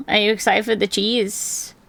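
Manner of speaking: normal talking voice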